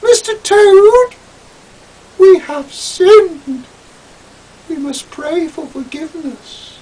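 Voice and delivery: very high, slow, pious lecturing voice; lecturing Julian. Acted. Julian lecturing Toad after sinning, very high and pious. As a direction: scold Toad like a prim preacher. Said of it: "He lectured the ugly, loathsome lecher".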